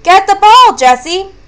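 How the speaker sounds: urgent yell, high and loud; high, loud storybook shout. A narrator's reading. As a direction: call out loudly to a teammate. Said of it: "Sam yelled".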